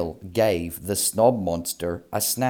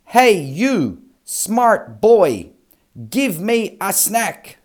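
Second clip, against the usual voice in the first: higher, louder demanding monster; bossy monster voice; 10 semitones higher, 6 dB louder than usual. Acted. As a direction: boss the snail around like a monster. Monster snaps fingers, demands snack.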